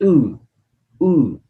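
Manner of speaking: "उ" said high and rounded, twice; French u repeated. Shown as a demonstration. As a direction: model the rounded "उ" for the class. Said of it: "थोड़ा सा पेश लगाना". ("उ" गोल होंठों से, दो बार ऊँचा)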